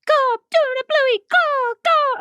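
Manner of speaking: mimicking the son's Bluey chant; imitating a child. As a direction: squeal it like an excited little boy. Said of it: "his is even more high-pitched".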